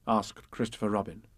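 plain reading voice

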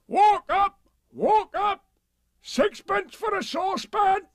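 high, loud, slow hawker cry; hawker's shout. Acted. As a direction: bellow walk up to the crowd. Market seller calling out.

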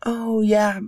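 high, chatty little sister; breezy know-it-all sister; bright girl fish voice. Acted. Leia speaks in the story.

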